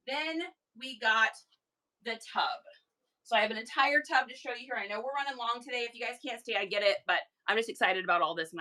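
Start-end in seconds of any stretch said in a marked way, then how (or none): 0.1-2.6 slow, deliberate reveal. Real, not acted. Seller presenting the tub a second time, slow deliberate reveal. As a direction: pause after "got" before "the tub". Repeats the announcement with pauses.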